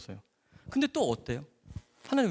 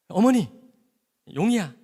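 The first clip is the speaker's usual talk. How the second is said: loud, urgent call; doctor bursting in, calling the mother and boy; 7 semitones higher, 9 dB louder than usual. Retold or imitated.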